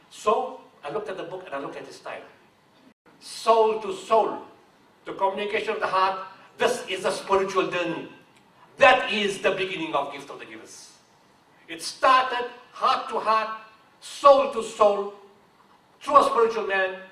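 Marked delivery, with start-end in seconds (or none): 3.2-4.4 soul to soul drawn out slowly and loudly; slow solemn emphasis. Acted. Founder stressing his charity's spiritual roots, slow and loud. 11.7-17.0 slow, loud and higher, like a sermon; preaching swell. Acted. Founder telling how the charity began, slow sermon voice.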